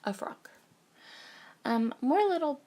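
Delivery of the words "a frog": froggy voice with a real cold; cold-roughened, croaky voice. Real, not acted. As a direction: talk with a frog in the throat. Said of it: "I sound like a frog".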